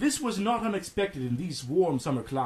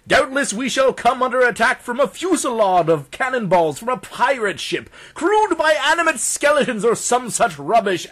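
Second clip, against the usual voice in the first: loud, higher-pitched mock groaning; groaning complaint; 6 semitones higher, 7 dB louder than usual. Doctor imitating guests who dread his arrival, loud groaning complaint. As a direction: moan theatrically, imitating exasperated party guests. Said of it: "greeted with weary groans and cries of".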